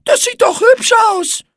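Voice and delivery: very high, loud spider squeal; squeaky, affronted protest; offended cry. A narrator's reading. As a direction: call out hurt and indignant. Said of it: "rief Igitte gekränkt".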